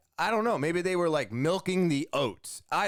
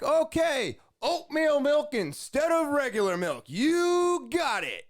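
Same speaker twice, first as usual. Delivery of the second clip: very high, hyped-up drive-thru voice; imitated high-energy yelling; 11 semitones higher than usual. Drive-thru worker confirming the milk swap, high-energy yelling. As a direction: belt it back with huge enthusiasm, stretching the sign-off. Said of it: "this guy has so much energy".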